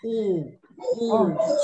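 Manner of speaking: O as a long pure letter sound; sound demo. Shown as a demonstration. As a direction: model a clear O, one at a time. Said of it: "has to be an O sound".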